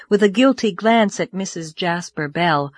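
usual speaking voice

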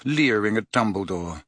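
ordinary delivery

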